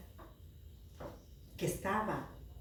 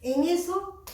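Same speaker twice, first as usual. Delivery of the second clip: ordinary delivery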